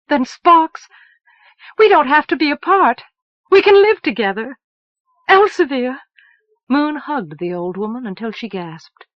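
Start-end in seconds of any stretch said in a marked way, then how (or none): 0.1-6.0 louder and higher, bursting with joy; a girl who suddenly sees hope, crying out gladly; overjoyed outburst. Acted. Moon to Elsevier, finding she can be with Sparks: joyful louder outburst.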